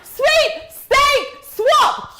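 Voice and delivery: slow, high, loud triumphant call; drawn-out cheer. Real, not acted. Host cheering the swap live, slow triumphant call. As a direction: stretch it out like a victory chant. Drawing her wanted team.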